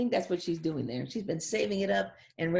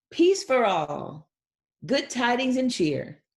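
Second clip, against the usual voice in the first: slow, much louder closing line; joyful proclamation; 4 semitones higher, 6 dB louder, slower than usual. Acted. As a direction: declare it to everyone. Reads final page.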